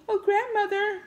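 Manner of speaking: high, mocking call; mock a clueless movie character calling out; high-pitched imitation of someone calling; mimicked calling out. Mimics film character calling grandmother.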